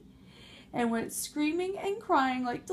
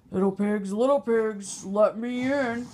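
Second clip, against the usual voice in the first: louder, menacing wolf call; big bad wolf; 6 dB louder than usual. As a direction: demand entry like a hungry wolf. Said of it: "that big bad wolf said".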